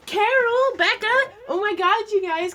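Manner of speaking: suddenly thrilled, calling to them; excited. Real, not acted. Excited realization calling out names.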